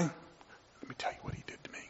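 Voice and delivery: hushed confiding whisper; gossiping whisper. Retold or imitated. Grudge-holder whispering gossip to a friend. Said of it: "that guy over there that".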